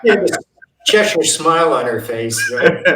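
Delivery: words bubbling with real laughter; laughing while talking. Real, not acted. Laughing as he tells the interviewer about Liz's grin. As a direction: laugh through the line.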